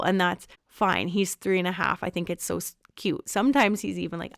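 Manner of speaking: ordinary talk, nothing marked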